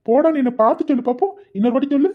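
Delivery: teasing loud dare to his sweetheart; playful challenge. Acted. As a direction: raise your voice in a teasing dare. Playful lovers' tease escalating.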